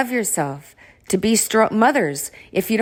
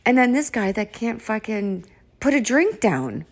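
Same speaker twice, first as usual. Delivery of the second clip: normal talking voice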